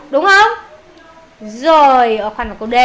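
normal talking voice

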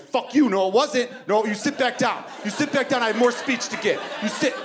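high-pitched, comic exasperated ordering; indignant outburst. Retold or imitated. Speaker to man getting off at his stop, indignant. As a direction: order him back, worked up and high.

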